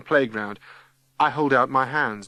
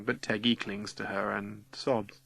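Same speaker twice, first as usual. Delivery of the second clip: ordinary delivery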